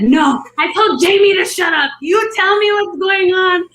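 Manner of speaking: high, indignant demand; retold yell. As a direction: imitate snapping back and insisting on an answer. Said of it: "I yelled at everyone".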